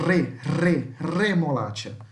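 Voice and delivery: rolled R on "re" and "remolacha"; teacher's demo of a vibrating R in "remolacha"; true vibrating R. Shown as a demonstration. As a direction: trill "re" twice, then "remolacha". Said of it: "Esse é o som do R verdadeiro".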